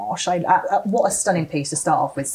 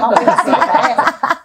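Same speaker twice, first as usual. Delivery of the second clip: laughing excitedly; 7 semitones higher, 9 dB louder, slower than usual. Real, not acted. Excited laugh on bears reveal.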